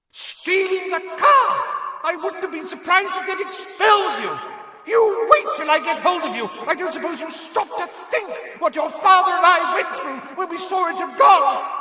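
Mrs Weasley's howler yell. A narrator's reading. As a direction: bellow like an enraged mum filling a hall. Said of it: "Missus Weasley's yells".

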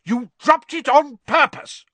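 high, loud furious accusation; roar. A narrator's reading. Father refusing his son's excuse, roaring. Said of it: "roared Mister Weasley".

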